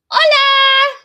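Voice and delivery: high, loud call across the room; loud greeting. Retold or imitated. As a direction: shout a bright hello to her. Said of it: "and I go".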